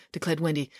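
ordinary delivery, nothing marked

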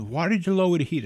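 loud, fast, high accusing yell, imitating the wife; retold yell. Wife yelling at husband over the frozen kitchen, loud fast mock yell. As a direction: fire the question at him, fast and loud. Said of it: "my wife's yelling at me".

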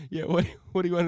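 a hammed-up wary cleaner; imitating Otis. Cleaner answering the prisoner, mock impatient voice. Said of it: "he's like".

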